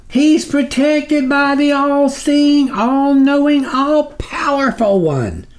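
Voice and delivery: high, slow pleading servant voice; groveling minion. A narrator's reading. Snuffer begging the sublord's patience, high and slow. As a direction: plead upward to your dark master.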